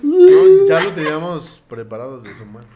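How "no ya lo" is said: sudden high loud no; loud protest. Real, not acted. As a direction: cut in with a big no.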